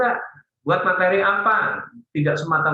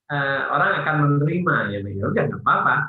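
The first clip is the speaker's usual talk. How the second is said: ordinary talk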